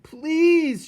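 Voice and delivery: high, loud pleading scream; desperate shout. Acted. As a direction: scream it in panic. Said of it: "shouted Despereaux".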